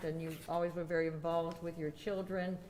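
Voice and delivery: ordinary talk, nothing marked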